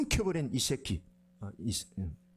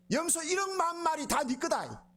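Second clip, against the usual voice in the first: high, expansive exclamation; generous exclamation; 12 semitones higher than usual. Retold or imitated. Father telling his son everything is his, soaring exclamation. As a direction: throw it open, voice soaring.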